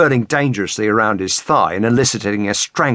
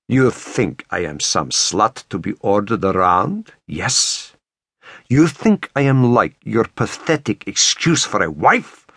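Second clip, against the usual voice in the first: put-on menacing Russian-accented murmur. A narrator's reading. Woman close against his ear, contemptuous Russian-accented murmur. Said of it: "whispered against his ear".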